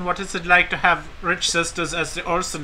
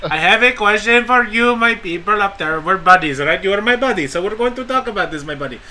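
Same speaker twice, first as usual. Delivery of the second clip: higher put-on broken-English accent; mock foreign accent; 6 semitones higher than usual. Podcaster plays a foreign fan begging for spoilers in a mock accent. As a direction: wheedle for spoilers like a pushy fan.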